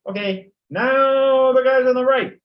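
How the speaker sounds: teacher directing the chant across the classroom; calling to class halves. Shown as a demonstration. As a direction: call to the right side, then the left. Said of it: "You can say".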